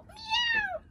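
very high imitated meow; cat's meow. Cat reacts to the smoky house, high meow. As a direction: yowl like a startled cat. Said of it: "maulló".

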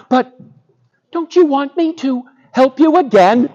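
high, small mouse voice; pleading mouse. Acted. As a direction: bargain timidly as a tiny mouse. Mouse bargains with Lion.

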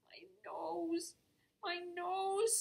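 high sobbing lament; mild high sob; sobbed. Acted. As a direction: whimper it out in distress. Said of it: "sobbed Peabody".

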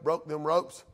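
ordinary talk

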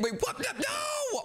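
sudden high cry of alarm; panicked high shout. Acted. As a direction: scream no as you're grabbed. Being dragged to prison.

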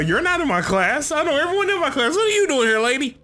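high, incredulous scolding; hammed-up indignant questioning; mock indignant teacher. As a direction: challenge the stranger in your class. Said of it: "Like, uh".

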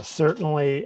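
normal talking voice

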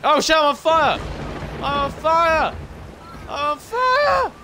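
high, loud panicked cries; panicked yelling. Real, not acted. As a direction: yell in rising panic. Set on fire in game.

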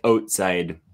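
"outside" with an oh vowel, "oatside"; common Canadian pronunciation. Shown as a demonstration. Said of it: "it's more common to say".

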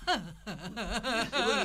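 very slow, stretched out by laughter; breaking up; laughing, trying to go on. Real, not acted. Teller laughing after punchline.

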